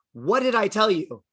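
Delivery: playful imitation of a parent's telling-off; mock angry mom. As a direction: demand an answer like a cross mother. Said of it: "gets all mad".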